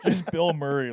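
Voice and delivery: ordinary talk, nothing marked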